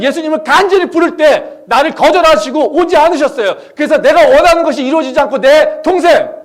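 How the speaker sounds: loud, high, impassioned pulpit shout; full-force preaching. Acted. Preacher contrasting Mary's refused prayers with Jesus' thanks, full-force preaching.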